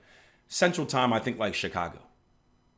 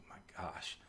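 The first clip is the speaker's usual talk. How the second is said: breathy, hushed disbelief; a stunned whisper; whispered my gosh; 17 dB quieter than usual. Retold or imitated. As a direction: let it out under the breath. Reacting to Darren's late night.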